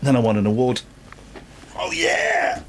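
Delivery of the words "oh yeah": excited cheer. Acted. Mock celebration of award.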